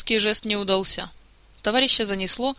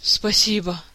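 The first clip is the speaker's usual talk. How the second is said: sighed thanks; slower than usual. A narrator's reading. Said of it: "вздохнул Элмар".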